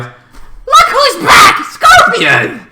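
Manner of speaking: very high, slow hyped yell; excited shout. Retold or imitated. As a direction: announce his return like a hype man. Hyped announcing Scorpion's return.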